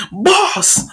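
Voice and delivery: high startled call; alarmed cry. Acted. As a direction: cry out for your boss. Crew sees boss stabbed.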